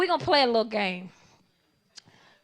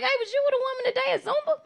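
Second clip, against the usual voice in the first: high, quick, sugary voice; high sweet question; 12 semitones higher, 4 dB louder, faster than usual. Retold or imitated. Girlfriend confronting boyfriend, high and fast. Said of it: "I was like".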